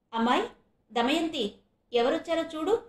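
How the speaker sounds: mother-in-law calling out to the daughter-in-law. Acted. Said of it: "కోడల్ని కేకేసింది".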